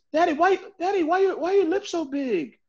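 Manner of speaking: put-on high, little-kid voice quoting a daughter; child's question. Dad retells his daughter's blunt question, high child voice. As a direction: pitch it up like a curious child.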